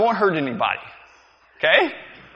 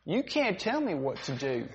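mocking sneer at the captain; defiant sailor; 6 semitones higher than usual. Sailor defying the captain, mocking sneer. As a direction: talk back to the captain with contempt. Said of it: "The demonstration".

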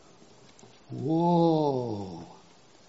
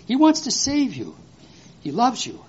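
earnest preaching appeal; 7 semitones higher, 9 dB louder than usual. Real, not acted.

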